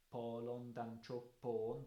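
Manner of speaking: "Polon dan tropon" slow, every syllable held long; long syllables stressed. Shown as a demonstration. Lecturer repeating spondees for students, slow and even. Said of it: "those are all long syllables".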